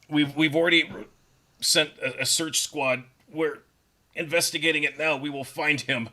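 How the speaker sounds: choked trooper. Acted. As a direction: squeeze the words out while being choked. Trooper being force choked.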